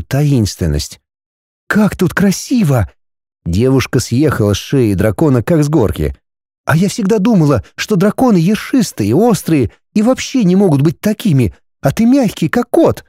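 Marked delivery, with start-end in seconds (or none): 1.7-2.9 high delighted exclamation; amazed young woman; delighted girl. A narrator's reading. Linda admires lake. 6.6-13.0 put-on high, quick girlish chatter; playful young woman. A narrator's reading. Linda chatters to dragon.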